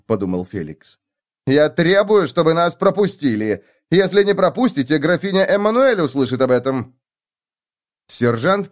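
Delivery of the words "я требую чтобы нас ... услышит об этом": imperious. Acted. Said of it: "повелительно".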